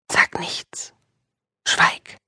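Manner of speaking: urgent whispered command; hushing whisper. Acted. Inner voice orders Julia to stay silent, whispered. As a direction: hush her with a whisper.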